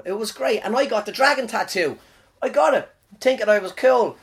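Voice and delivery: normal talking voice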